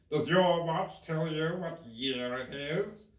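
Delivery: drawn out and loud like the Hatter. Acted. Hatter remarking on Alice's hair, slow and loud.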